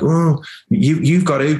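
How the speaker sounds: a hint of a preachy parent insisting; bossy parent. Imitating a parent who skips veg nagging the kids. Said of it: "they'll be like".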